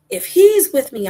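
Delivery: high, loud, stretched preaching cry; fervent declaration. Real, not acted. Preacher declares peace within God, fervent and loud. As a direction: proclaim it with full conviction.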